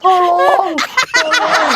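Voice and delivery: high pretend call of tolong; mock help cry. Shown as a demonstration. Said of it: "Dia akan berteriak". (ayah pura-pura berteriak tolong)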